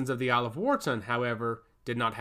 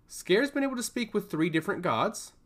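higher, surprised question; curious; 7 semitones higher than usual. A narrator's reading. Mother surprised at news of her son. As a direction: ask in disbelief. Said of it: "she asked curiously".

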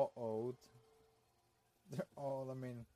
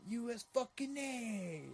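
high, slow, drawn-out mock cheer; mock patriotic holler; hammed-up patriot; 10 semitones higher, slower than usual. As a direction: stretch it out like an over-the-top patriot.

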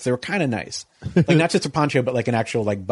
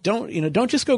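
worried plea; 9 semitones higher than usual. Retold or imitated. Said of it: "was like".

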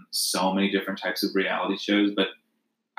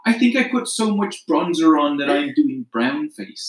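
high, slow mock contestant; hammed-up, high-pitched comic impression; parody sketch voice; 11 semitones higher than usual. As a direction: imitate a reality-show parody, pitched up. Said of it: "you watched that S N L parody".

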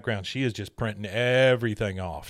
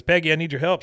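lightly voiced Hank, a bit raised; mild Hank plea; 6 semitones higher, 5 dB louder, faster than usual. Hank coming into the office asking Peggy for help. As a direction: ask for help, a touch louder. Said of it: "Hank comes in and says".

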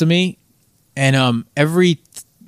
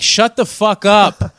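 annoyed cellmates; 7 semitones higher, much slower than usual. Retold or imitated. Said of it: "everybody just be like".

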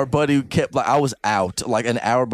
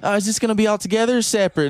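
higher, fast mock waitress voice; a light hammed-up lady bartender; imitating the bartender lady; 8 semitones higher, faster than usual. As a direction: toss off a quick mock server question. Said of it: "this lady's like".

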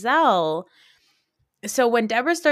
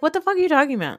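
high-pitched imitated brush-off; dismissive disbelief; 8 semitones higher, much faster than usual. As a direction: act out a baffled, couldn't-care-less retort. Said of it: "gave no shits".

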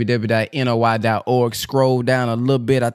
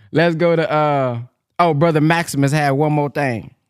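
ordinary delivery

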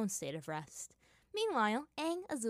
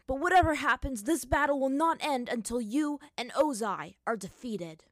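determined hero vow; 6 semitones higher, 7 dB louder than usual. Acted. Hero vows defiantly.

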